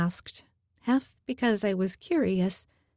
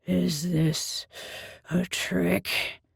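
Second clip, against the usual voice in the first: bellowing Minotaur; much slower than usual. A narrator's reading. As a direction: bellow it with suspicion. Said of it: "the Minotaur bellowed".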